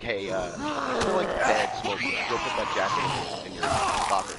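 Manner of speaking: hammed-up teacher busting stoned kids. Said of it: "he goes over to them".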